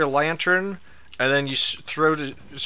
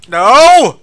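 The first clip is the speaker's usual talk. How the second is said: long, high, loud cry of no; real high shout of dismay; dismayed scream; 7 semitones higher, 15 dB louder than usual. Real, not acted. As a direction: wail it at full volume. Losing in game.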